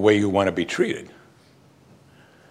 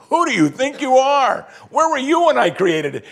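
much higher and louder, a booming divine challenge; God confronting Job; 12 semitones higher, 4 dB louder than usual. Acted. God rebuking Job from the whirlwind, booming challenge. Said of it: "confronted Job, and said".

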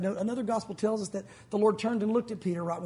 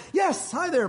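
flustered greeting; 6 semitones higher, 4 dB louder, slower than usual. Retold or imitated. Covers up, greeting the stranger.

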